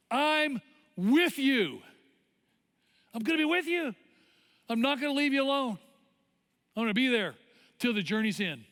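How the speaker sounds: high, emphatic promise, easing at the end; God's answering voice. Acted. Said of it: "The Lord is answering you".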